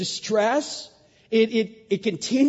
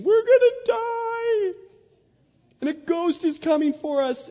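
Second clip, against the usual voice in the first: hammed-up panic, pitched way up; panicked disciples; 13 semitones higher, 5 dB louder than usual. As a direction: cry out like men sure they'll drown. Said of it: "They're panicking".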